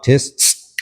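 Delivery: two short sharp hissing sounds, tis then tss; correction hiss. Shown as a demonstration. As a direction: hiss twice at the dog to check him. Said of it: "walk up in front of your dog".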